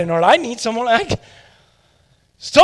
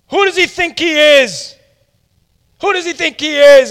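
higher and louder, huffing the same question twice; sputtering offended pride; fuming repeat; 7 semitones higher, 6 dB louder than usual. Acted. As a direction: Naaman storming about the prophet.

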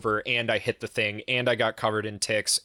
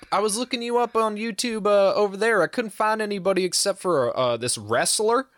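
high put-on cop voice, much higher; mimicking the cop; 10 semitones higher than usual. Cop who looked him up on YouTube, high mock voice. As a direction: play the cop chatty and pitched way up. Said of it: "he's like".

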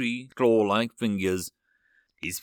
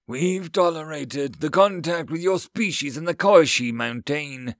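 higher, louder, aggrieved complaint; angry; 5 semitones higher, 5 dB louder than usual. A narrator's reading. Alien counselor complaining about human contact, angry. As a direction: lay out the grievance with force.

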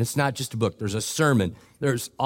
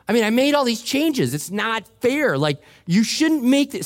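indignant complaint; 9 semitones higher than usual. Real, not acted. Complains about unfairness.

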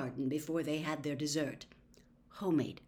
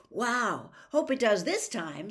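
put-on louder, eager boy; enthusiastic; 4 semitones higher, 7 dB louder than usual. A narrator's reading. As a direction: sound thrilled about a long match. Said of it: "said Harry enthusiastically".

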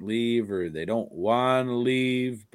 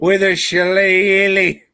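louder hammed-up Irish lilt; mock Irish accent; 7 semitones higher, 11 dB louder than usual. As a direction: play a stage Irishman, loud. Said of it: "With an Irish accent".

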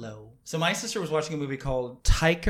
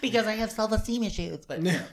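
mock diva voice; 5 semitones higher than usual. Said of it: "better when you say it in that voice".

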